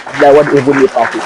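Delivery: ordinary talk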